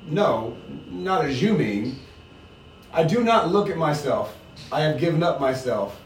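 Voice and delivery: reading a novel aloud to the group; steady read-aloud with the characters lightly voiced; story reading. To the book group, reading the chapter aloud.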